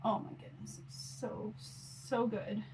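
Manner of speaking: hushed delight. Real, not acted. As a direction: breathe it out in soft awe. Savoring remembered bread with soup.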